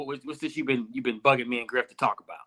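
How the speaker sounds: low, soft, offhand needling; quietly put-upon ribbing; dismissive tease. Real, not acted. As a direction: toss the question off as if bothered. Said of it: "Why you gotta say it like that".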